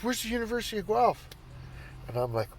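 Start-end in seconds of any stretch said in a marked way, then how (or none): 0.0-1.3 high-pitched puzzled question from his daughter; baffled daughter. Retold or imitated. Dad imitating his daughter asking, high and baffled. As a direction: mimic her total confusion. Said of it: "She goes".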